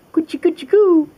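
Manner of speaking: high, sing-song coochie coo; baby-talk coochie coo; tickling coo. Acted. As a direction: tickle a spider playfully. Tickling tarantulas.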